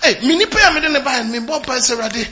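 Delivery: loud, high fervent preaching; preaching at full force. Acted. Pastor urging the congregation to win souls, fervent and loud. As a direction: drive the point home with fire.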